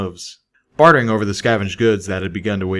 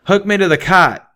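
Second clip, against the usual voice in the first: higher, louder pegasus pressing his plan; higher, louder Calamity insisting mid-firefight; insistent; 10 semitones higher, 8 dB louder than usual. A narrator's reading. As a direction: push it through, no arguing. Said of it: "Calamity insisted".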